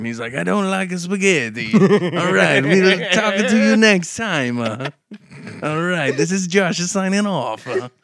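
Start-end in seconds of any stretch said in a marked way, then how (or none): 0.3-1.7 high mock Italian; Italian accent. Imitating an Italian guy at work, high Italian accent. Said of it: "we got an Italian guy at work". 1.7-7.7 loud, high mock Italian; Italian accent. Host sending off listeners, loud Italian accent. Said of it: "Do the Italian".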